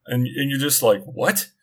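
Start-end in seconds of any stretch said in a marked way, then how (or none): 1.0-1.5 higher, rising mock-baffled question; retold disbelief. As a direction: toss it out as a baffled reaction. Retold baffled reaction to sluggish car.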